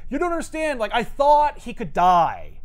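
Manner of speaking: high, slow, pleading insistence; exasperated Bruce. Retold or imitated. Bruce trapped in the Hulk, explaining, exasperated. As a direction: plead as if nobody gets it.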